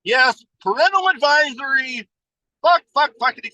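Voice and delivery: high, shouted stream of swears; swearing shout. Acted. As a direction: yell the swears for fun.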